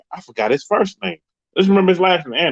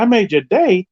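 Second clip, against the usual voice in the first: high, louder disbelieving "I made your day?"; surprised question; 8 semitones higher, 4 dB louder than usual. Retold or imitated. Spin asking the fan in surprise, high and louder. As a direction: voice jumps up in surprise.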